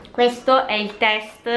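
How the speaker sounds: squeaky voice from inhaled helium. Acted. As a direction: talk on a lungful of helium. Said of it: "È bellissimo ogni volta con questa voce".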